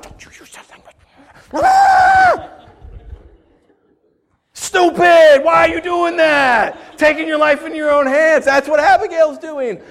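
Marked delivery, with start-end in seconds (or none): 0.0-1.0 barely audible whispered scolding; whispered rebuke. Retold or imitated. Wife to her angry husband, whispered rebuke. 1.5-2.5 very high, loud mock scream; angry scream. Husband exploding at his wife, mock angry scream. 4.5-8.4 loud, high, drawn-out scolding; exasperated shout. Acted. Preacher scolding a reckless risk-taker, exasperated shout.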